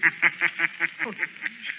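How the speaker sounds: high, echoing sinister laugh; ghostly triumphant cackle; eerie mocking laugh. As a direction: laugh like an unseen phantom mocking his prey. The Shadow laughs at captors.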